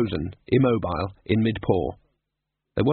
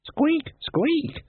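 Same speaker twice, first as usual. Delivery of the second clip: rat squeak. Acted. Death of Rats squeaks.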